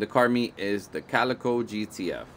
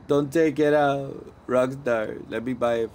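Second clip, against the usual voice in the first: mock pleading; 5 semitones higher, 3 dB louder than usual. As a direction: beg Rockstar not to pull the car. Pleads with Rockstar.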